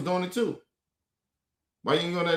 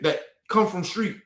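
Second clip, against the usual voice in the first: ordinary talk, nothing marked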